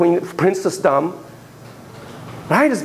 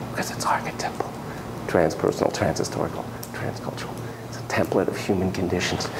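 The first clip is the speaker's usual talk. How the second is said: whisper; 12 semitones lower, 10 dB quieter than usual. Real, not acted. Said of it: "whispered list of big words".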